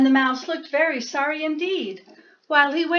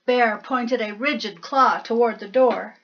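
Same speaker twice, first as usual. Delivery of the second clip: usual speaking voice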